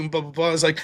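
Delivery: ordinary talk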